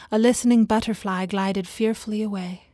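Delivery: ordinary delivery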